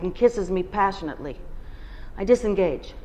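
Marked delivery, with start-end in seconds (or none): none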